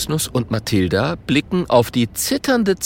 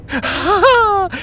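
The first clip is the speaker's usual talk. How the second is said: high ghostly cackle; laughing; 4 dB louder than usual. A narrator's reading. Ghost hearing of the pig plan, laughing. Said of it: "lacht Gerd".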